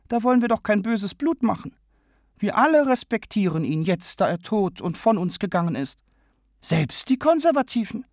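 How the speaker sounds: deutlich höhere, eindringliche Männerstimme; O'Connor, fragend. A narrator's reading. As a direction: fragt empört hoch nach. O'Connor-Figur, versöhnlich. (O'Connor protestiert gegen den Königsbesuch, hoch und eindringlich)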